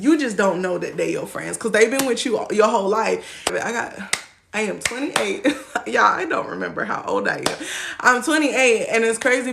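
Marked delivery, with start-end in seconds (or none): none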